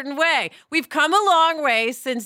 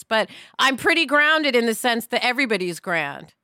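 ordinary talk

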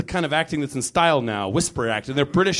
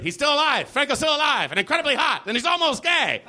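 high, breathless gossip excitement; gossip blog alert; 11 semitones higher than usual. Retold or imitated. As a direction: gush like a tabloid headline. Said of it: "as a daily alert".

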